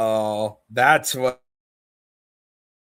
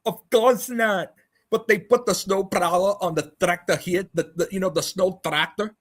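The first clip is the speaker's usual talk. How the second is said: mocking impression, much higher; impression of Banjo; 7 semitones higher than usual. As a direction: play a clueless man, pitched up. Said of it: "That is my impression of fat ass Banjo".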